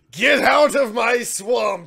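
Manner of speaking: drawn-out, high hammed-up battle yell; banishing yell. Game master acting out the druid's dire moment, banishing yell. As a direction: bellow it slowly like an epic banishing. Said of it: "banish something to another realm by yelling".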